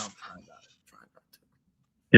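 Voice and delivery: a near-silent whisper as the emotion chokes him; holding back tears. Real, not acted. Recalling the Saints game, he fights back tears in a whisper. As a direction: breathe the words out, almost breaking. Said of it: "'cause I'm gonna get emotional".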